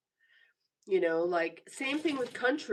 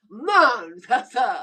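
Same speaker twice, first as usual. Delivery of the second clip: Manchester slow and loud, British a, no r; mock British accent; 3 semitones higher, 11 dB louder, slower than usual. Shown as a demonstration. Asking the viewer if it's right, mock British. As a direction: say Manchester slowly like a Brit. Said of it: "Is that how you say it?".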